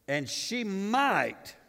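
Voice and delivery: slow teasing hint. Real, not acted. Slow suspenseful tease.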